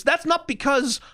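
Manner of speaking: ordinary talk, nothing marked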